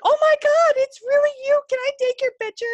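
high-pitched, loud celebrity-fan squeal; mock fangirl shriek, very high; starstruck fan. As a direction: gush as if you've just spotted a star. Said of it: "I acted like she was a celebrity".

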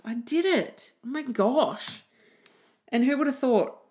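high-pitched triumphant exclamation; delighted triumph. Real, not acted. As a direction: celebrate in amazed delight, pitch jumping up. Celebrates recalling the list.

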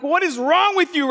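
high-pitched, incredulous mock outrage; exasperated scolding. Man to a raccoon that keeps coming back, exasperated. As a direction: scold it in rising disbelief.

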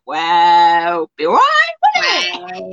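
wailing it out, half shouting; mock posh wail. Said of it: "So they were like".

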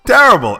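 terrible thrown up high and loud; emphatic repeat of terrible; stress on terrible. Real, not acted. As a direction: hit terrible hard on a high jump. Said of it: "It was terrible".

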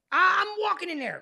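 high, loud, stubborn declaration; defiant insistence. Retold or imitated. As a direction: refuse to be wheeled in. Defiant patient quoting himself.

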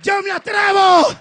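"Yo me atrevo" shouted high and loud with a rise; bold shout. Acted. Moses boldly volunteering before God, shouting. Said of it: "dijo Moisés".

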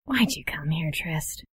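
hushed, whispered question; soft unvoiced murmur of sadness; sad whisper. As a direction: whisper it sadly, barely there. Sad character greets Trist privately.

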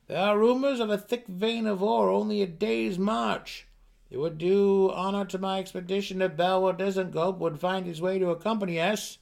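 high, loud, formal herald voice; booming messenger. Acted. A herald announcing the king's news to Drizzt, loud and high. Character voice in read story.

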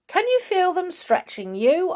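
a high, teasing Mister Twit voice; Mister Twit voiced. A narrator's reading. Husband taunting his tied-up wife, high and teasing. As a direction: taunt her as if asking kindly. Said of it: "asked Mister Twit".

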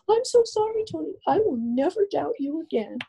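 mother's apology. Retold or imitated. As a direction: voice the shaken mom begging forgiveness. Mother apologizing after the shooting.

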